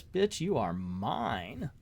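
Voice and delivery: low, slow, soft menacing threat. Retold or imitated. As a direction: breathe it out slowly as a cold threat. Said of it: "And Terra's like".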